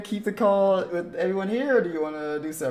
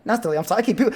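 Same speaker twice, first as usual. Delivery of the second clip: ordinary delivery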